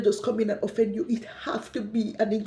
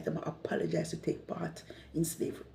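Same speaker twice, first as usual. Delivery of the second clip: whispered aside; 11 dB quieter than usual. Real, not acted. To her viewers about African leaders, a bitter whispered aside. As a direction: drop to a breathy whisper on "they apologize to take part in slavery".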